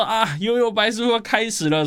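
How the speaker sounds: high-pitched exclamation, normal volume; excited realisation. Acted. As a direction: exclaim it high, as if just recognising the theme tune. Acting out recognising the theme music. (兴奋地恍然大悟，音调偏高)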